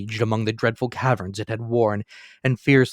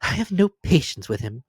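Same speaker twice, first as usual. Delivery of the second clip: higher, a touch of annoyance; lightly voiced exasperated young wife; impatient niece; 5 semitones higher than usual. A narrator's reading. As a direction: dismiss him crisply. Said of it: "observed Scrooge's niece".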